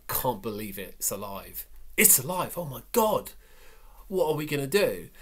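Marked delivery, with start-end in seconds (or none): 2.0-5.1 high, loud panicked outcry; panicked disbelief. Retold or imitated. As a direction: cry out in horror at a live lobster. Acting out Belacqua's panic.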